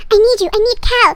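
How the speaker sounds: high, loud mock desperation; desperate plea. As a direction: call out like a melodramatic heroine. Girl sends psychic plea.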